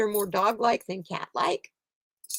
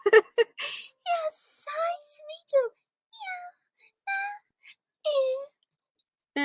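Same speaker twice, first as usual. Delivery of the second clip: much higher cooing "sweet little meow"; pet voice; much slower than usual. Real, not acted. Owner cooing at the cat, "sweet little meow" very high.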